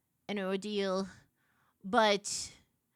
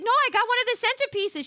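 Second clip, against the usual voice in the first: flustered; 11 semitones higher, 6 dB louder, faster than usual. Retold or imitated. Explaining to sister in a rush.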